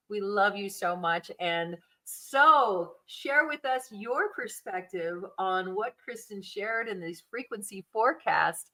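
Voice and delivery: usual speaking voice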